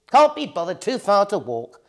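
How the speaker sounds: high whiny lazy tone; can't be bothered; lazy witch. A narrator's reading.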